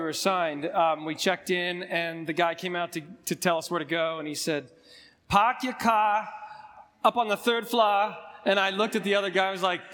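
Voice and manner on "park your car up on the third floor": slow, drawn-out mock Boston parking attendant; lightly voiced Boston attendant, slow; imitating a Boston accent. Parking attendant giving directions, in a slow mock Boston accent. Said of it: "those are my first Boston words I heard".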